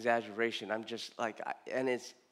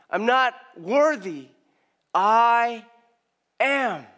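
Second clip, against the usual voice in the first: loud, high, emphatic preaching cadence; 8 semitones higher, 8 dB louder than usual. Acted. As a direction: preach it out with full force, word by word.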